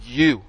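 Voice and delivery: "You" set alone between long pauses; single out "You" as a word by itself; low, weighted "You", left hanging; stress on YOU. Shown as a demonstration. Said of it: "is emphasized also".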